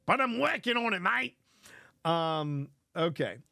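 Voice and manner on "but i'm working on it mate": a light Aussie twang on mate; mock Australian accent. Streamer jokes to viewers in a mock Australian accent. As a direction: toss in a cheeky Australian mate. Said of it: "my Australian accent".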